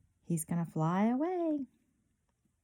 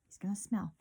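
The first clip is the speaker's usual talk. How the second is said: ordinary delivery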